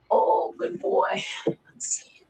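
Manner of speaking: breathy whisper, slow; whispered praise to dog. Real, not acted. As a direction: hush it to the dog as you praise.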